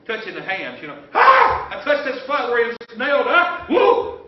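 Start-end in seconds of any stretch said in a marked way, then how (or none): 1.1-4.2 high, loud thrilled cries; excited shrieks. Acted. Disciple who touched the nail wound, excited shouting. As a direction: squeal like you can't believe you touched it.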